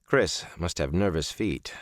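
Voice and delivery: ordinary delivery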